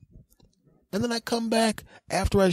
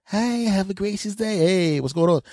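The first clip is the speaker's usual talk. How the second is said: mock cashier greeting; 4 semitones higher than usual. Cashier's first greeting over machine.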